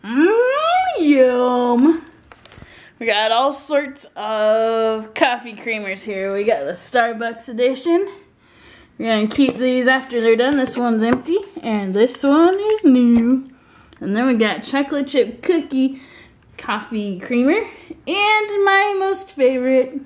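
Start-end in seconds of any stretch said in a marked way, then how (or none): 0.0-2.0 excited. Real, not acted. Excited about coffee creamers. 18.1-20.0 gushing. Real, not acted. Gushing about favorite creamer.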